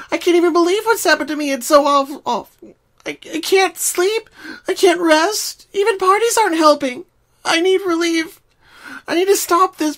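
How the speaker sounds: terrified and near breaking; quivering voice. Acted. Pinkie tells Twilight her awful problem, terrified and loud. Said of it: "Her voice was quivering".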